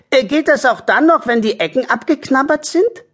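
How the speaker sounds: high, hesitant question; doubtful character voice. Acted. Meinrad asks Harry about the nibbled card, high doubtful voice. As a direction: query it doubtfully, pitched high.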